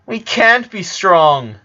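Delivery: slow, high tearful reply. A narrator's reading. Lori answering Lincoln with a new tear, tearful and slow. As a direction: say it near tears, slowly. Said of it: "with a new tear falling".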